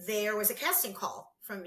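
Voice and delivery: ordinary delivery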